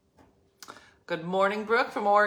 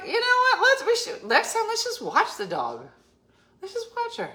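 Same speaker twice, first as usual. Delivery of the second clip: high retold quote; 14 semitones higher than usual. Retold plan to partner, squeaky.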